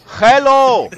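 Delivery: loud bright call; shouted greeting to the crowd; calling out hello. Real, not acted. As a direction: call out to the room.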